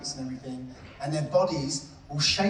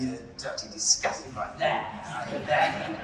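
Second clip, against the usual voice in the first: lower, half-whispered mock loathing with a laugh; mock disgust; 6 semitones lower than usual. Showing the class how to scold the hate jar, mock disgust. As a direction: sneer at the jar in hammed-up disgust. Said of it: "rice and go".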